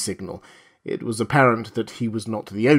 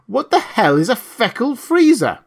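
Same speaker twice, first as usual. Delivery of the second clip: loud, high, exasperated shout; shouted question; 10 semitones higher, 5 dB louder than usual. A narrator's reading. Husband to wife over the uproar, shouting. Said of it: "Burkhart shouted to his wife over the uproar".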